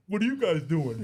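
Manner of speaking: a lightly voiced teenage son coming downstairs; teenage skater boy. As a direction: son interrupting the crying family, as a skater teen. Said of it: "teenage skater boy".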